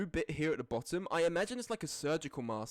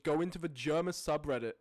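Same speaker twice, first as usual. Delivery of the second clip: ordinary talk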